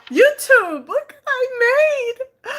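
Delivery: high, loud, drawn-out squeal of delight; thrilled high-pitched announcement; excited showing off. Real, not acted. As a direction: squeal it slowly to the audience, very high. Excited greeting to viewers showing food.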